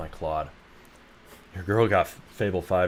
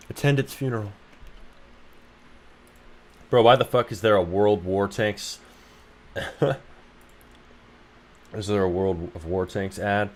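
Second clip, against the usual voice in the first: ordinary talk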